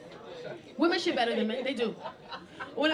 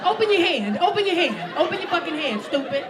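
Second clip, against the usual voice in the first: demanding; 5 dB louder than usual. Acted. Acting out bathroom scene.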